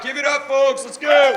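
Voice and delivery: loud, high crowd-pumping shout; hype shout. Real, not acted. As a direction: fire up the room for the next act. Calling up next comic.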